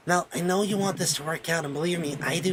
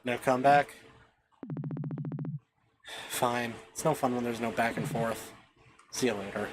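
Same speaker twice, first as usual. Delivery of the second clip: low taunting villain; 8 semitones lower, 5 dB quieter than usual. Acted. Villain taunting Mel after Allison leaves, low mocking gloat. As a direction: gloat quietly over the heartbroken girl.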